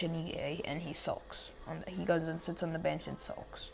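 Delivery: very soft, sinking into a whisper; hushed telling. Shown as a demonstration. Telling viewers how the boy sulks on the bench, hushed. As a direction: let the voice drop away almost to nothing. Storyteller drops voice on sulking.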